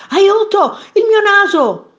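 high, loud cry for help; alarmed cry. A narrator's reading. Man at the mirror finds his nose gone, alarmed cry. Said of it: "gridò".

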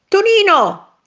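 high, loud call from the balcony; mother calling out. A narrator's reading. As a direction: call the child's name across the yard. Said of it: "gridò in quel momento la mamma". (richiamo forte e acuto)

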